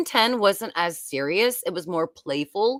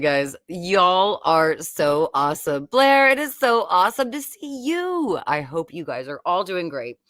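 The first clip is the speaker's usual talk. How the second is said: usual speaking voice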